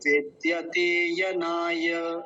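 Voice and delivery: विद्यते यनाय slowly, linked, long vowels; pronunciation demo. Shown as a demonstration. Teacher showing "like this", slow linked demo. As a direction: draw out the "tay" and "naa" of विद्यते यनाय. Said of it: "ऐसा".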